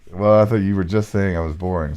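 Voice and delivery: ordinary delivery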